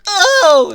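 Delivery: loud high pained oh; mock pained cry; pained groan. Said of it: "Donatello's like".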